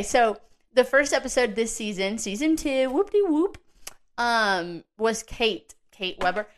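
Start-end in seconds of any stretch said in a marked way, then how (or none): 2.9-3.6 sing-song cheer. Pretend. Mock celebration of new season.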